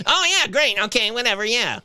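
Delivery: lightly voiced exasperated mother; mom voice. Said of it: "I would talk like this was my mom".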